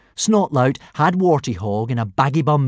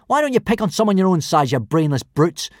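quick, higher-pitched telling-off; snapped retort; 7 semitones higher, 4 dB louder than usual. A narrator's reading. Boy standing up to the bullies, snapping. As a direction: snap it out indignantly. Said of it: "snapped Hiccup at the bullies".

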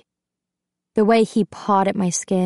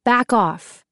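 firm warning, a touch raised; mildly raised, mean command; 3 semitones higher than usual. A narrator's reading. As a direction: warn him off meanly. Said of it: "more meanly".

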